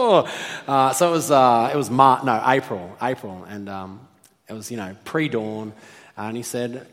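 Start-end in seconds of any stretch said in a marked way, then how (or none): none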